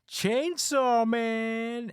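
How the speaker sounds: high, loud, long-drawn summons; calling out. Retold or imitated. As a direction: chant the name out like summoning a hero. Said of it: "Let's call 'em together".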